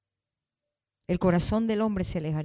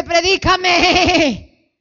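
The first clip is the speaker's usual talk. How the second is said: loud, high, long-drawn bleat; bleating sheep; 10 semitones higher, 11 dB louder, much slower than usual. Acted. Little sheep demanding a sermon, drawn-out bleat. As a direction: drag the last syllable out as a baa. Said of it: "La ovejita dice".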